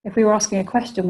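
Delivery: normal talking voice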